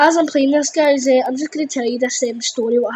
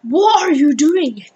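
imitating a shouted telling-off, louder and drawn out; slow, loud mock outrage; retold teacher's shout; 3 semitones higher, 5 dB louder than usual. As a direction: mimic a teacher shouting at a pupil. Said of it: "shouts at him, goes".

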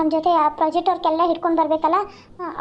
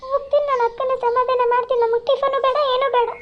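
put-on higher, girlish younger sister voice; 6 semitones higher than usual. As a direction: comfort your crying big sister. Younger sister consoles crying sister.

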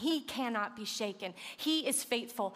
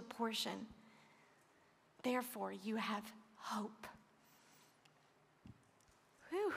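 softer and softer, voice nearly gone with tears; through real tears; 4 dB quieter than usual. Real, not acted. Preacher crying as he reassures listeners, fading tearful voice. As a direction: let the tears take the words down to almost nothing. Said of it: "I didn't expect to cry".

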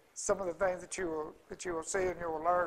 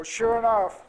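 ordinary talk

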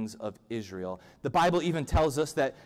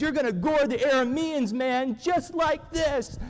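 high, loud frat-party shout; hammed-up rowdy bellow, rising in pitch; wild proclamation; 11 semitones higher, 5 dB louder than usual. Horned prophet proclaims victory while running around, shouting wildly. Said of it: "and he proclaims".

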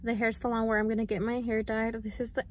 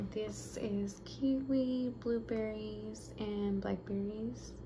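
soft near-whisper; 8 dB quieter, slower than usual. Real, not acted. Showing meal prep fruit softly.

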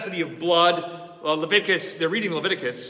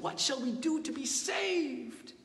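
soft, higher, anxious asking; hushed plea; 9 semitones higher, 8 dB quieter than usual. Retold or imitated. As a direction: ask it quietly, as if cut to the heart. People asking at revival.

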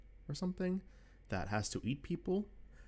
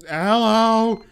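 startled; 10 semitones higher, 16 dB louder than usual. Acted. Answering the walkie-talkie.

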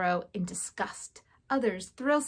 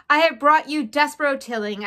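loud, raised proclamation; shouted announcement; 4 semitones higher, 7 dB louder than usual. A narrator's reading. Furlow delivering his brother to the council, shouting. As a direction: present him formally to the hall. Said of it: "shouted Furlow".

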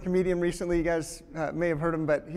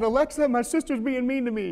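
put-on higher and louder, a child complaining to Alexa; little girl tattling; 6 semitones higher, 5 dB louder than usual. Father mimics his daughter tattling to Alexa, higher and louder.

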